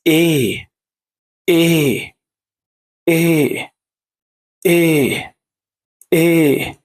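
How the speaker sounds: long "إيه", held like "eyy" and falling; second E sound. Shown as a demonstration. Teacher drills the second pronunciation of English E, long "إيه". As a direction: hold the vowel of "إيه" each time. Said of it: "النطق التاني بتاع الحرف الإي".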